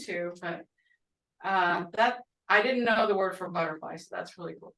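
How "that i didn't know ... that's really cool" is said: ordinary talk, nothing marked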